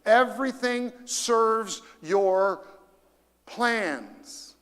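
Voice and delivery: everything drawn out, slow and weighted; slow emphatic reading. Shown as a demonstration. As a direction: read slowly, lean on everything. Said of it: "read those last four words with me".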